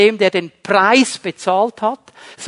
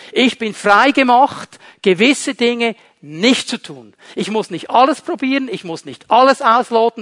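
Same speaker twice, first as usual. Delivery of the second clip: ordinary delivery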